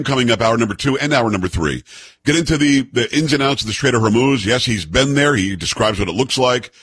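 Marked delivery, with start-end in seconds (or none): none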